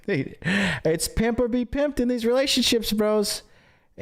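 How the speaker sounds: normal talking voice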